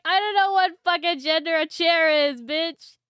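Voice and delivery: loud, frustrated rant; exasperated yell. Retold or imitated. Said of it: "I'm like".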